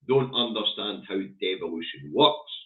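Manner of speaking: normal talking voice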